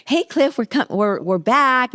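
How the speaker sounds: high, eager greeting on the phone; bubbling over as they call Cliff back; excited call. Retold or imitated. Newlyweds phoning the seller, excited and high. Calling old man excitedly.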